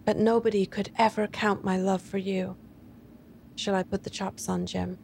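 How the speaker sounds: put-on soft, tender young wife, fading quiet; serious sweetness. A narrator's reading. Della reassuring her husband, soft and sweet. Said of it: "with sudden serious sweetness".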